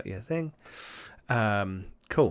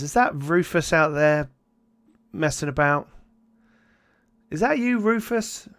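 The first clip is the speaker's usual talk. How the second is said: calling out; 6 semitones higher than usual. Acted. Innkeeper calls into the night.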